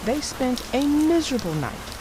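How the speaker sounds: lively read-aloud storyteller voice; story narration. A narrator's reading. Narrator reading the Bear family story aloud to kids. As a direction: tell it like a bedtime picture book.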